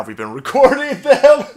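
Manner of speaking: loud, high gleeful reveal; James O'Keefe. Acted. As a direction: crow it, laughing, as a gotcha.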